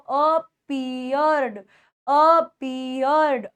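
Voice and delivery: appeared stretched syllable by syllable; slow, broken-up appeared; slow pronunciation model. Shown as a demonstration. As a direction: draw out "a-pee-yerd" for students to repeat. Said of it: "बोल के देखो".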